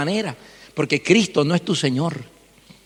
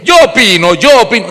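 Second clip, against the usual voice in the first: mock opinionated believer; 4 semitones higher, 8 dB louder than usual.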